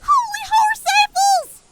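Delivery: high, startled shout. Acted. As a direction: yell it out in sudden shock. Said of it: "Apple Bloom shouted".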